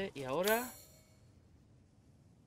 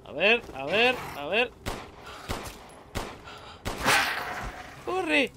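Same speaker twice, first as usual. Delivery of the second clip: panicking gamer; 7 semitones higher, 4 dB louder, slower than usual. Real, not acted. Fighting off attackers in panic.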